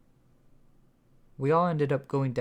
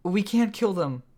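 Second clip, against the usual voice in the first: higher and louder, pleading; cried out; 8 semitones higher, 6 dB louder than usual. A narrator's reading. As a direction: call out to stop the killing. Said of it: "Sunflower cried out".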